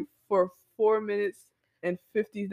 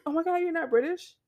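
high-pitched incredulous question; exaggerated surprise in a high voice; mock astonished interviewer; 8 semitones higher than usual. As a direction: act amazed, voice jumping up. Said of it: "they be like".

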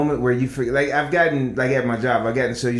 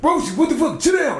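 high, hammed-up parent scolding a kid; mock annoyed parent; 14 semitones higher than usual. Parent scolding a kid just being a kid, mock annoyed. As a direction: snap at the kid like a fed-up parent. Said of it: "the parents just".

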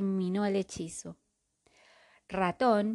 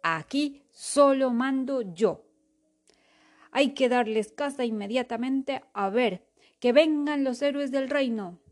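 commanding king voice; 4 semitones higher, 5 dB louder than usual. Acted. King commands his court.

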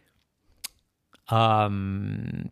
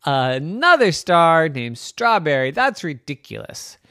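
higher, emphatic mock disbelief; scoffing; 5 semitones higher, 5 dB louder than usual. Planet ridicules a star named Strawberry, scoffing. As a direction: scoff as if it's absurd.

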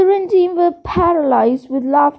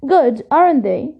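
plain reading voice

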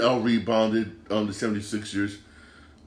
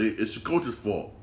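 ordinary talk

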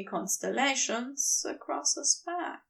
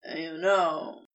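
much lower, careful opener; careful beginning; 9 semitones lower than usual. Acted. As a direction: start low and cautious. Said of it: "he begins carefully".

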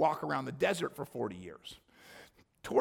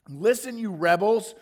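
higher, louder shout; 5 semitones higher, 8 dB louder than usual. A narrator's reading. Moses yelling at the rebellious crowd, loud shout. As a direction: bark it at the rebels. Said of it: "he shouted".

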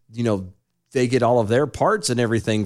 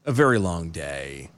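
low, quiet and worn out; real weariness, sinking softly; sounding tired; 6 semitones lower, 6 dB quieter, slower than usual. Real, not acted. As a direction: sigh it out, drained. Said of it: "I apologize for sounding tired".

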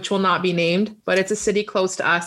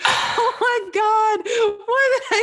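laughing disbelief; 11 semitones higher, much slower than usual. Retold or imitated. Speaker's retold reaction, laughing.

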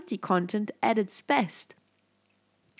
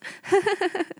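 high laugh; 10 semitones higher than usual. Real, not acted. Laugh after own announcement.

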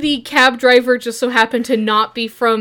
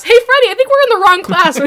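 higher, louder call to Freddy; student calls out to Freddy, higher and louder; 9 semitones higher, 6 dB louder, faster than usual. Retold or imitated. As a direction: shout the realization across the room. Same words called to Freddy.

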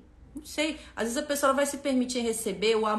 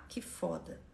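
much softer, lower murmured "que foda"; hushed echo; 5 semitones lower, 10 dB quieter, slower than usual. Real, not acted. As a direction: echo it to yourself under your breath. Awed echo of own words.

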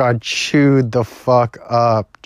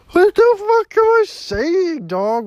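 much higher, cracking disbelief; squeaky outburst; 4 dB louder than usual. Real, not acted. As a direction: yelp it at yourself. Catches himself rambling.

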